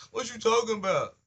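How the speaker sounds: high, loud "what you talking about"; confronting challenge. Acted. Calling out someone talking indirectly, loud and high.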